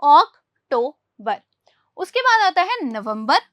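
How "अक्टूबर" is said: अक्टूबर slow and louder, ok-toe-buh; slow model word. Shown as a demonstration. Teacher drills अक्टूबर slowly and loudly for the class. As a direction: stretch अक्टूबर out with a rounded o. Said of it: "क्या बोल सकते हैं".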